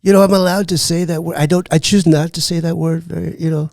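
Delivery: impersonated politician holding forth; Donald Trump. Acted. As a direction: play the president on a comedy show.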